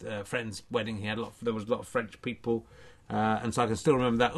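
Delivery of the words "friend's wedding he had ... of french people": usual speaking voice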